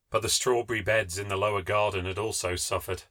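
ordinary delivery

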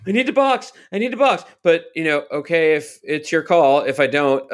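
imitating a driver panicking at his crew over the radio; mock freak-out. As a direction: panic at your pit crew, half-pleading. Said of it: "His freak out at his own crew".

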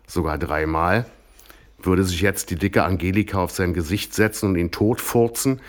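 usual speaking voice

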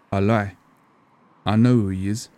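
ordinary delivery

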